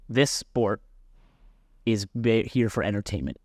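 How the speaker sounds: normal talking voice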